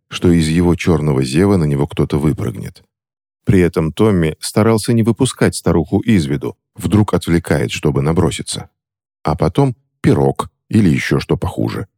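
usual speaking voice

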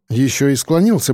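ordinary delivery, nothing marked

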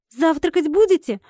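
ordinary delivery

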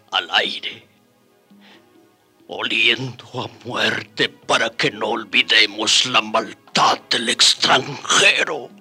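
broken, sobbing recollection; grief-stricken. Acted. Survivor recalls the massacre, breaking down in grief. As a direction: force the words out through tears.

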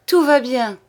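high, loud cry of relief; relieved exclamation. A narrator's reading. Old man hears his secret was kept, crying out. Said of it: "s'écria le père Tabaret".

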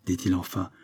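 ordinary delivery, nothing marked

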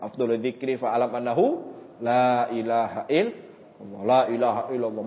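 imam-led recitation. Retold or imitated. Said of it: "diajak oleh imam".

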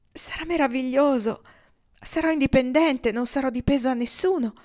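usual speaking voice

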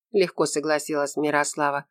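usual speaking voice

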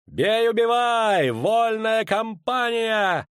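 very high, very slow shout; battle cry. A narrator's reading. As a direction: scream the charge.

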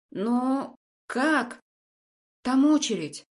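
high, incredulous outburst; astonished protest. A narrator's reading. Patient protests there is a waiting list, astonished. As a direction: blurt it out in disbelief.

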